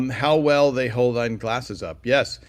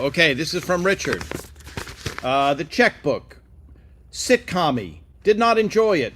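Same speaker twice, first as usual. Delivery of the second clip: imitating Ray reading aloud; 5 semitones higher, 3 dB louder than usual. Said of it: "he would read this whole review".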